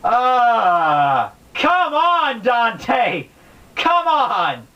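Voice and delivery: a high, exasperated groan at the author; exasperated outburst. Real, not acted. Explaining hell's tenth ditch, fed up with Dante's endless sections, exasperated. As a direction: throw your hands up, fed up.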